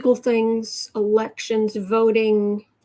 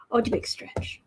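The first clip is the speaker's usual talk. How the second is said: whispered coo; 6 dB quieter than usual. Real, not acted. Whispering to her stretching pet, fond coo.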